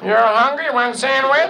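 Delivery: put-on high, accented, chirpy bandleader voice. Bandleader interviews a hip trumpet player, high and chirpy. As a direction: play the square TV bandleader hiring a jazzman.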